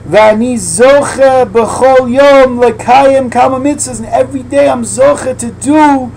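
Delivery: high, loud, slow rapture; fervent preaching. Acted. Preacher voicing the heart's prayer, loud and high. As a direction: cry out each phrase with joy.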